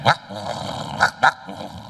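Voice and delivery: breathy imitated barking; mock dog bark. Preacher mocking the crazy dog, imitated woof. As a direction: bark like a mad dog, lightly.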